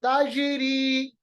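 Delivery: slow ताजिरी with bounced j; qalqalah. Shown as a demonstration. Teacher gives the jeem example, ताजिरी with springing j. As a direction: echo the j in ताजिरी, long ending. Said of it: "जीम की मिसाल".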